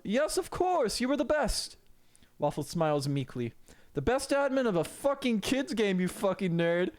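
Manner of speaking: high mocking gatekeeper voice; Saint Peter. Saint Peter mocking Waffle before kicking him, high and sneering.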